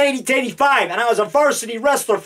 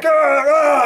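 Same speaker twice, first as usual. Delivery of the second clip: loud hammy double growl; shouted monster roar; 5 semitones higher, 4 dB louder than usual. Acted. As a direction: roar at the camera twice. Attacking with brick.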